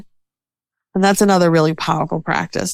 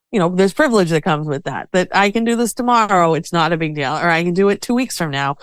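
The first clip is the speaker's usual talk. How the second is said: ordinary talk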